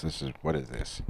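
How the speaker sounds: lightly put-on English accent, quiet; slipping into a mild English accent. To himself about the bad Sharpie, in a soft English accent. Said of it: "why am I talking in an English accent?".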